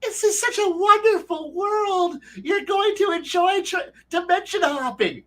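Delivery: very high, slow sugary mock-cheerful voice; mock cheerful host. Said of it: "I don't just walk up there and be like".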